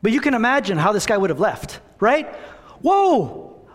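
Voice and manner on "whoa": high, loud cry of amazement; amazed whoa. Pretend. Forgiven servant staggered by his freedom, amazed cry. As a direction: gasp it out like unbelievable news.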